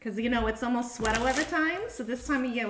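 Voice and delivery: doing the SNL sweater weather New York voice; New York accent. Pretend. Reader riffing on sweater weather, in mock New York accent. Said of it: "we gotta start talking like this".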